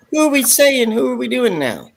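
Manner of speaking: very high put-on voice; put-on character voice. Reading out a listener's question, high put-on character voice.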